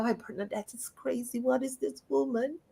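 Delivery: hushed pretend aside from onlookers; mock bafflement. Imagining what listeners say about her, in a hushed mock aside.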